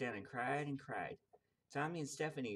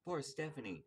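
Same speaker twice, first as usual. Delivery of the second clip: ordinary delivery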